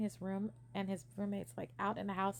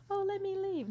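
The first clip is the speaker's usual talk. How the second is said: a higher, breezy, offhand ask; mock casual; 11 semitones higher, 5 dB louder than usual. Showing she was not just asking casually, mock offhand. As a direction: toss it off like it's no big deal. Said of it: "I wasn't just like".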